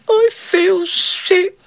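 high, slow sickly whine; high drawn-out whinge; whining young queen. A narrator's reading. Sulking queen voiced.

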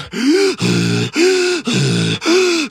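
high, ragged gasp for air after a long run; out-of-breath gasp. Acted. Farmer run out of breath gasps after fleeing the mule. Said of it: "he's going".

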